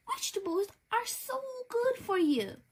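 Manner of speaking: high, quick, sing-song mother's voice; coaxing mom. Acted. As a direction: sweetly persuade a fussy child. Mom coaxes child at dinner.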